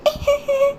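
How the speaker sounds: put-on high, eerie child's laugh; spooky high-pitched titter; ghostly giggle. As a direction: giggle like a haunting little ghost. Ghost girl threatens blood.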